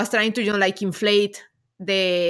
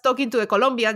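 usual speaking voice

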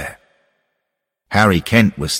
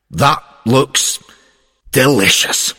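relishing; 6 semitones higher, 5 dB louder, slower than usual. Acted. Relishing the cap before biting.

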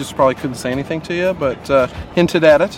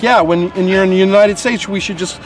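higher, teasing mock suggestion; sarcastic reply to a friend's travel plan, pitched up; 6 semitones higher than usual. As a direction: play it as an absurd suggestion, poking fun. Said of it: "And I'm like".

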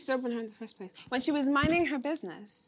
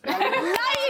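laugh; 11 semitones higher, 3 dB louder than usual. Real, not acted. Teasing friend over ghosting.

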